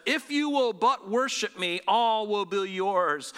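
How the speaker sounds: slow, grand tempter's proclamation; Satan making a slow, drawn-out offer; Satan's offer. Retold or imitated. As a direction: draw out the tempting promise, starting high. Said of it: "Satan says".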